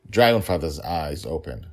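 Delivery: ordinary delivery, nothing marked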